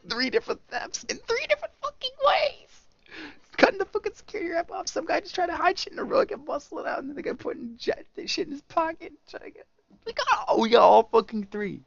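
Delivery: high, rapid excited rant. Real, not acted. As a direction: gush about catching all three. Excited recap of three thefts.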